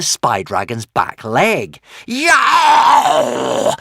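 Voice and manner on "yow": high, loud yelp of pain; yelling in pain. Acted. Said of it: "yelled the spy dragon".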